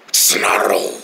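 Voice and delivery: growled word, like an animal; mock beast snarl; gator snarl. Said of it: "he's snarling at me".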